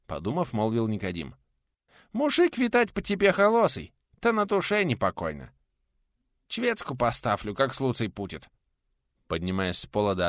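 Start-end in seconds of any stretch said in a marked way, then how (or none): 2.1-8.5 high-pitched voice with mushy consonants; high lisping villager; lisping peasant, sympathetic. A narrator's reading. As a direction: speak kindly through a split lip, high.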